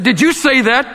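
jolted burglar blurting it out; startled. A narrator's reading. Burglar startled, asking the parrot, high alarmed question.

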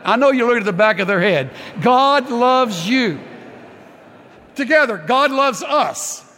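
loud, high, rousing preacher's call; fervent altar-call preaching; full-force preaching. Acted. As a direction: lift the whole room, preach it out loud.

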